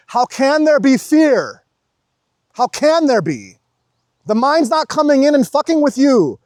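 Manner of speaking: high, loud preacher-like fervour; impassioned exhortation. Real, not acted. Urging viewers to live without fear, loud and fervent. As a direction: urge them on at full voice.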